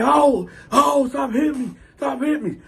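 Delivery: high-pitched mock plea; whimpered-up retelling of a protest; pleading. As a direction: imitate someone begging not to be hit. Retold cellmate begging.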